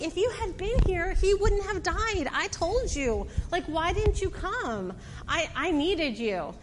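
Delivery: imitating an upset reproach; pleading reproach. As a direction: plead as a let-down, grieving sister. Martha reproaches Jesus in grief.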